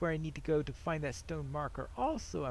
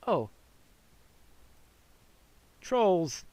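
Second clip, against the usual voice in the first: normal talking voice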